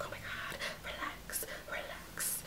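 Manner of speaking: hushed, voiceless muttered plea for calm; self-calming whisper. Retold or imitated. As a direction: whisper it to yourself to keep cool. Telling herself to keep cool.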